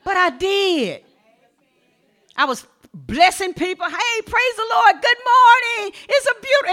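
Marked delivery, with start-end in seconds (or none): 3.9-6.7 high, bright hello to passers-by; cheery greeting. Retold or imitated. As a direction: greet strangers like it's a glorious day. Retells greeting strangers.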